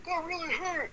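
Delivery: very high, thin imitation of a hurt man; mock pained squeal. Wrestler mimicking the man he chopped, high mock-pained whine. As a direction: squeal it in falsetto, mocking his pain.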